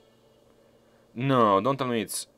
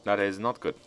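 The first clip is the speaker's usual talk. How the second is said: ordinary delivery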